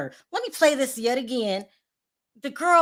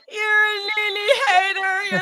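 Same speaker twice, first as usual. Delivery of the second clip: slow, high whiny mock of the critics; a mocking, high-pitched whine at the haters; 9 semitones higher, 4 dB louder, slower than usual. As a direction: parody the accusers in a slow, high, whining voice. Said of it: "people say".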